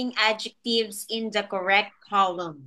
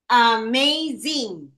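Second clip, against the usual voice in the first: "Amazing" slowly split into three beats; syllable by syllable; slower than usual. Shown as a demonstration. Teacher showing three syllables to pupil, "Amazing" slowly syllable by syllable. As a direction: sound out "Amazing" with each syllable apart. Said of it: "that's three syllables".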